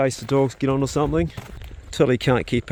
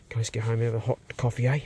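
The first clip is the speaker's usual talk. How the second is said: ordinary delivery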